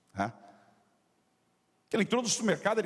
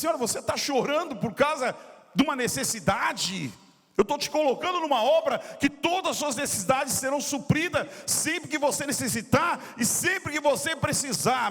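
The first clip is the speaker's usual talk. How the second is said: high, forceful preaching voice for God; God's emphatic assurance; 9 semitones higher than usual. Retold or imitated. God answering the crying pastor about his needs, emphatic assurance. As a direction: declare the promise with mounting insistence. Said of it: "Deus falou ao meu coração, tão claro".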